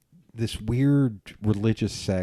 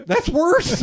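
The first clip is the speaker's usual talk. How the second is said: much higher, mock-offended retort; mock indignant friend. Friend correcting the speaker's term, high mock indignation. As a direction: play the young friend scolding you. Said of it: "she was like".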